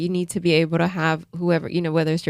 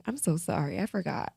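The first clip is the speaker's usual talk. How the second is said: much softer, contrite imitation; retold soft apology; 10 dB quieter than usual. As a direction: voice his hushed, sorry reply.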